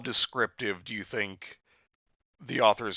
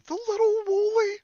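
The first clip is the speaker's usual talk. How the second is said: very high, loud squeaked question; incredulous; 6 dB louder than usual. Real, not acted. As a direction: squeak it back in disbelief. Incredulous repeat of what was read.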